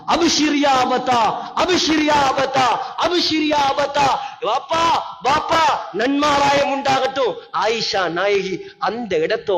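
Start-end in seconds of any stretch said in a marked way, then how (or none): 0.1-4.1 higher, rising with each repeat; calling out good news. Acted. Messenger calling good news to Abdullah, excited. 4.4-7.3 higher, raised call; son calling out. Acted. Son calling the good news to his father, excited.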